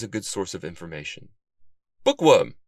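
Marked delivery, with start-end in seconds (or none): 2.0-2.6 loud, higher call across the library; exclaiming. A narrator's reading. Detective greets his friend in the library, exclaiming. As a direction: burst out with the name. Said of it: "Sylvester exclaimed".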